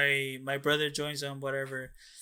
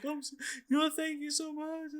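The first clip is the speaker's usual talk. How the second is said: tearful thanks; 11 semitones higher, 6 dB quieter than usual. Retold or imitated. Wife thanking brother on the phone, tearfully. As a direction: imitate a wife weepily gushing thanks, voice rising high.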